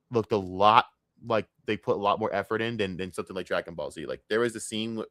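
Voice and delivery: usual speaking voice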